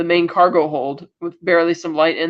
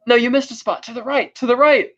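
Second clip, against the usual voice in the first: higher-pitched mock taunt; retold wisecrack under torture, pitched up; joking defiance; 6 semitones higher than usual. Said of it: "developing a sense of humor about it".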